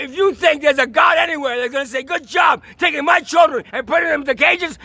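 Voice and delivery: real yelling rage, pitched high; furious shout. Real, not acted. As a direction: shout it at the judge, all fury. Furious rant against the courts.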